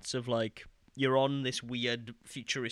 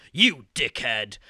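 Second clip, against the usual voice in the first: loud, drawn-out mock insult; badly delivered voice line; 3 semitones higher, 11 dB louder, slower than usual. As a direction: blurt it slowly and loudly, like bad game acting. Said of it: "in the worst delivered voice line".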